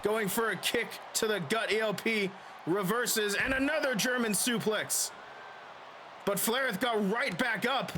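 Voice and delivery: lively play-by-play call; wrestling commentator. Acted. Plays a wrestling game, commenting.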